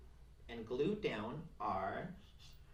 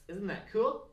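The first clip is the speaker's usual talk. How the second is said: high and loud, delighted question; excited; 7 semitones higher, 6 dB louder than usual. Real, not acted. Craft teacher showing antlers on sticks to kids, excited. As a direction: show it off proudly.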